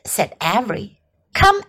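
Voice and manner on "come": high, insistent mother's command; Mrs. Arable crying out. A narrator's reading. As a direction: call the child out, voice pitched up. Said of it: "cried Missus Arable".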